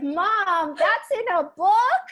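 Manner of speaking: put-on high, loud, slow shocked child; mock shocked kid. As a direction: play the kid gasping at the book, slowly. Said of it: "He's like".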